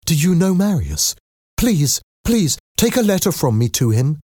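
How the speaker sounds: high-pitched, eager begging; surprised pleading. A narrator's reading. Young woman begging Eponine to carry a letter, pleading. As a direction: beg with the pitch lifted high. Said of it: "eyes widened in surprise".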